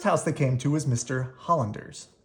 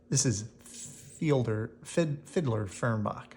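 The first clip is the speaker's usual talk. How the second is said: commercial announcer; 3 semitones lower, 4 dB quieter, slower than usual. Acted.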